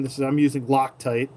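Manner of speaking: normal talking voice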